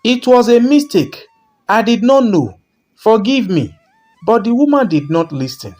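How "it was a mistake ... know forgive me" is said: pleading cry. A narrator's reading. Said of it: "she cried".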